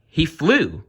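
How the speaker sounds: high, loud crow answer; crows in chorus. Acted. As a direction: answer together, pleased. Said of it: "The crows all spoke at once".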